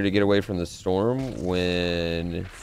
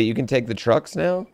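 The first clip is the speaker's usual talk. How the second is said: ordinary delivery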